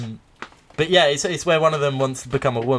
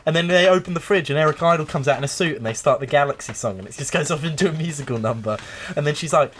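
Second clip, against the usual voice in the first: ordinary talk, nothing marked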